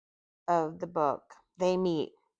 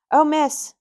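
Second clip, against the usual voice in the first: higher, louder, half-hearted try at Scottish; attempted Scottish accent; 7 semitones higher, 5 dB louder than usual. Apologetic man to a fallen woman, in an attempted Scottish accent. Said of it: "I'll, I'll try".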